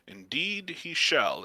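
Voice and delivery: slow, formal priest's confirmation; lightly voiced, measured priest; solemn priest. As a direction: confirm it slowly as a stately cleric.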